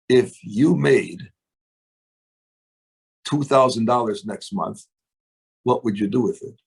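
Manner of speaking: low, slow and unhurried, each phrase set apart; slow calm talk. Shown as a demonstration. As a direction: ask it gently with long pauses, as if to a prospect. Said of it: "You could talk like this".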